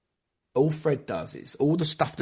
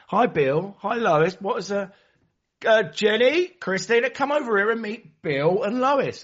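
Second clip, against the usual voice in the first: much higher, slower, bright hostess calling others over; chirpy host; 9 semitones higher, 4 dB louder, slower than usual. Retold or imitated. Member calling the others over to meet Bill, chirpy and high. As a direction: sing it out like an over-keen welcomer.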